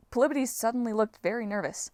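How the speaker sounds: usual speaking voice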